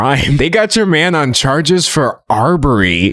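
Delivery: ordinary talk, nothing marked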